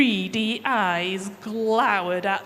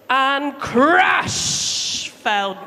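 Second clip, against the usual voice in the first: dramatic crash; 3 semitones higher, 4 dB louder, much slower than usual. Acted. Dramatic fall of Goliath.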